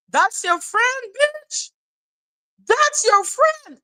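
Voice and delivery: high, slow sing-song taunt; high mocking jeer at the fake friend. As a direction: rub it in, mockingly. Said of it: "taunting repeat".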